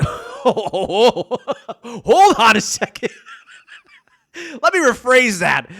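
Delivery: breaking into laughter while halting yourself; laughing self-correction. Real, not acted. Podcaster catching own innuendo before rephrasing, laughing.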